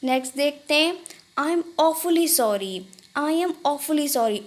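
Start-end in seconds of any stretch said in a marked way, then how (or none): 1.4-4.4 slow, careful teaching delivery; Hindi: धीरे-धीरे उच्चारण; slow model phrase. Shown as a demonstration. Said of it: "awfully मतलब होता है".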